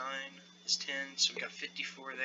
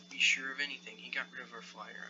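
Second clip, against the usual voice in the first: normal talking voice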